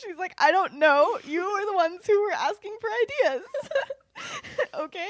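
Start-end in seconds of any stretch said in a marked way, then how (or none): 0.4-3.4 high, loud mock exasperation; exasperated comeback. Friend answering Sirius's challenge about ideas, exasperated. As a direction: throw the question back, loud and high.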